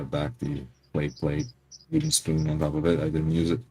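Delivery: ordinary talk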